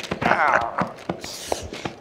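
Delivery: breathy chuckle. Acted. Sully laughs at Nate.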